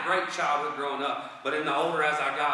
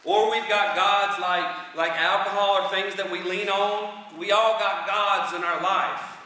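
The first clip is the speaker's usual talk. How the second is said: raised, slow, emphatic delivery; high, strong, drawn-out proclamation; forceful preaching; 4 semitones higher, 5 dB louder, slower than usual. Real, not acted. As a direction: drive it home to the congregation. Preacher rising to full force.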